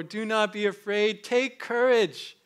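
Jesus calling over the waves, slowly; urgent encouragement. Acted. Preacher urging, voicing Jesus.

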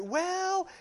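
put-on high, light little girl voice, lightly done; a hint of a little girl; daughter's voice. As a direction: mimic his young daughter weighing her options.

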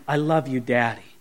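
soft, low, tender murmur; hushed affection, falling at the end; endearing. Shown as a demonstration. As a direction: say it gently, like a child to a loving parent. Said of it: "in the endearing way that a child".

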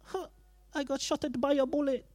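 high, hammed-up groan of a man just shot; mock shot victim. Preacher jokingly playing someone shot, mock wounded. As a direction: ham it up like you've been hit by a bullet. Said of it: "I'd be like".

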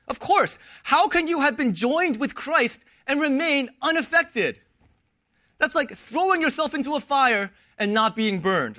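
ordinary talk

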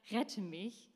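usual speaking voice

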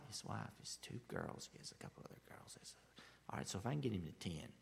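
fast whispered counting; whispered mental math. Retold or imitated. Abraham counting righteous people in his head, whispered. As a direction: whisper the tally to yourself, quickly. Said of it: "doing some math in his head".

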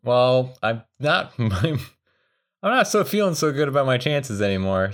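ordinary talk

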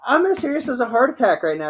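high, fast insistence; frantic insistence. Retold or imitated. Insisting to dad he is serious, high and fast.

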